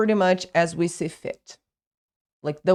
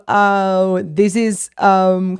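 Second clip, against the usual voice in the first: ordinary talk, nothing marked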